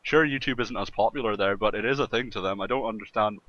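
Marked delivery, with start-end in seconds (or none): none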